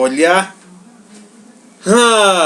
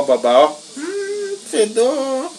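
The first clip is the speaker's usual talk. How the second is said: ordinary talk, nothing marked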